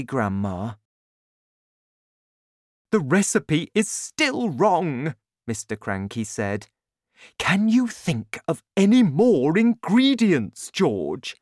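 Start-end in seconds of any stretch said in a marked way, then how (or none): 2.9-5.1 higher, emphatic father voice; frustrated father in a story; Mister Cranky, frustrated. A narrator's reading. 7.4-11.4 high, loud, insistent dad; eager father questioning his son; Mister Cranky, pressing. A narrator's reading. Narrator voices Mister Cranky.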